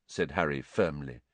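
usual speaking voice

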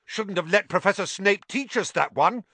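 high, furious jab. Acted. Harry scolds Lockhart furiously. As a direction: fling the words in anger. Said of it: "said Harry furiously".

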